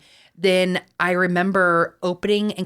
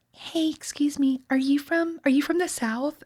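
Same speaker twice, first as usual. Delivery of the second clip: imitated curious stranger; 8 semitones higher, 4 dB quieter than usual. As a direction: play a stranger gently asking, pitched up. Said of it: "the girl next to me was like".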